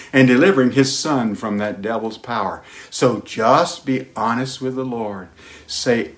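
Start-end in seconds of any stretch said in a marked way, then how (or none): none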